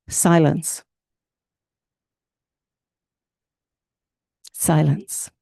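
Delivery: a weighty word set apart by long pauses; slow weighty word. Shown as a demonstration. Preacher naming the hard practice, weighty and slow. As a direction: let it sit alone, heavy. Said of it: "here's the big one".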